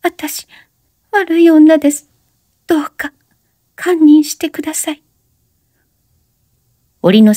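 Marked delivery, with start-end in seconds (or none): 0.0-5.0 high crying voice, broken; weeping confession; tearful plea. A narrator's reading. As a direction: sob out the apology. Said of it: "泣き声の中で".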